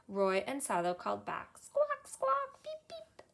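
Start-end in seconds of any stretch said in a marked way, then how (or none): 1.6-3.3 very high, breathy bird calls; squawks and peeps. A narrator's reading. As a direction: answer like a little bird. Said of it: "answered the egg".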